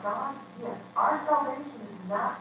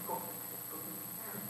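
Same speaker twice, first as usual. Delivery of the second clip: whispered command; 17 dB quieter than usual. Acted. Hidden command.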